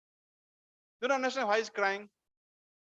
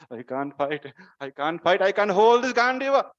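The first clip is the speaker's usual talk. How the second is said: panicked refusal, building to a loud cry; acted breakdown, swelling in volume; distraught Arjuna; 13 dB louder than usual. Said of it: "imagine how Arjuna is speaking".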